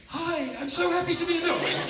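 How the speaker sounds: high put-on voice. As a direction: pitch it way up for a mock hello. Mock high voice after deep voices.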